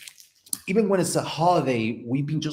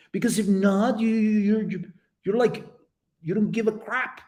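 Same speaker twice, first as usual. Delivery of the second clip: normal talking voice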